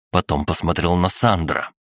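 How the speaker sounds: ordinary delivery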